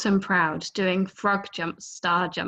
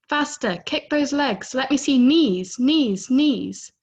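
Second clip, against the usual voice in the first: high, loud barking orders; drill teacher; 6 semitones higher, 6 dB louder than usual. Retold or imitated. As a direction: crack the whip like a swim coach. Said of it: "Teacher cracks the whip".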